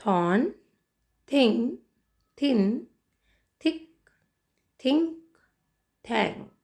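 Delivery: थॉर्न to थैंक, each opening on थ, word by word; repeat-after-me pronunciation. Shown as a demonstration. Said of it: "एक बार फिर से हम इसे प्रोनाउंस करते हैं".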